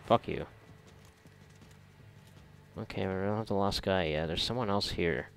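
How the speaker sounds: normal talking voice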